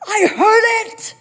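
high, loud mock-panicked yell; hammed-up alarm, loud and high; alarmed shout. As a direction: stretch it out as a startled husband's cry. Acts out husband jumping from bed.

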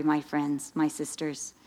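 ordinary delivery

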